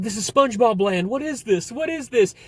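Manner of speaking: high, loud amazed friend voice; tripping buddy. Retold or imitated. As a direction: play the friend lost in his trip, baffled. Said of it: "my buddy's like".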